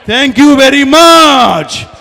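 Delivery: huge shout with a long-drawn last word; rousing shouted thanks. Shown as a demonstration. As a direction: stretch it out like a stadium announcer. Leading crowd in rousing thanks.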